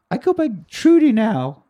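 lightly voiced young girl; little girl voice. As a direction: say it like a proud kid. Girl announcing her nickname.

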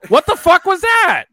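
normal talking voice

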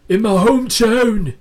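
loud, high impression of a wrestler's interview; British Bulldog. Podcaster mimicking British Bulldog's interviews, loud impression. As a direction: belt it out in a put-on wrestler's voice. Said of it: "but the British Bulldog".

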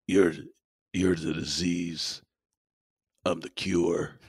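low, soft, slow action-hero delivery; Stallone impression. Co-host doing the Cobra line on request, Stallone impression. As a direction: drop it low and drag the words out. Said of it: "Can you do the, uh, line".

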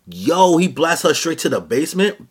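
high, loud, incredulous question; amazed outburst. Real, not acted. Reviewer seeing her blasted to the basement, amazed. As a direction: burst out in excited disbelief.

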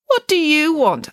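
high, loud, drawn-out challenge; suspicious. A narrator's reading. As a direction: question them warily, slowly and up high. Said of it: "asked Judith Piggins suspiciously".

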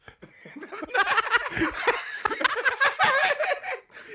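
loud laugh; high shrieking laugh. Real, not acted. Big laugh.

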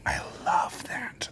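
slow whispered love; hushed whisper. Real, not acted. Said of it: "whispered aside".

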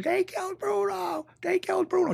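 very high, drawn-out mock scream; shrieky pitch without full volume; imitated screaming. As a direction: imitate a frantic woman screaming down the phone. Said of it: "she was screaming".

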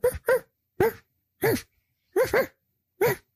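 short, high, spaced-out ruffs; imitated barking. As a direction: mimic a small dog barking on and on. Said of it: "barking, right?".

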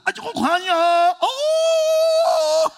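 high, loud mock shriek cut with a cry; a hammed-up scream of surprise at the tip; shrieking manicurist. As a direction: squeal it out like the startled manicurist.

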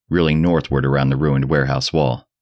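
ordinary delivery, nothing marked

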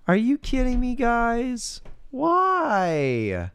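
high, incredulous cry; why would they do that; outraged disbelief. Real, not acted. Outraged at CGI.

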